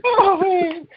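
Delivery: very high words squeezed out of a laugh; uncontrollable laughter. Real, not acted. Host failing to get back to normal, uncontrollable laughter. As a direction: still squealing from a laughing fit. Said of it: "I can't stop laughing".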